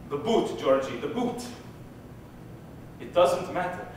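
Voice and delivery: put-on soft, low, subdued old grandfather; hushed, rueful confession; quiet regret. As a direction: say it softly, heavy with regret. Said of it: "told me with regret".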